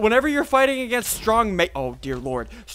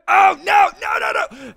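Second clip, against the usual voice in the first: loud high cry of dismay; high frantic protest; alarmed shout; 10 semitones higher, 6 dB louder, slower than usual. Real, not acted. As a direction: yell out in sudden panic. Attacked in game.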